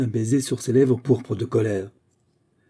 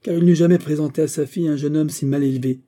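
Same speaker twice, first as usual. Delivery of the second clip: indignant retort, higher and louder; raised voice of offended pride; courageous indignation; 5 semitones higher, 6 dB louder than usual. A narrator's reading. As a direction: answer back boldly, stung. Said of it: "répliqua Christine avec une courageuse indignation".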